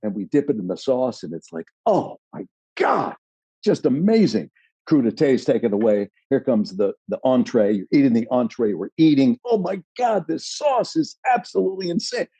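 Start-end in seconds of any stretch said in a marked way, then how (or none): 1.9-4.5 high, gushing rave; raving delight. Retold or imitated. Raving over the sauce. 9.4-12.3 high, gushing rave; raving delight. Retold or imitated. Raving over the sauce.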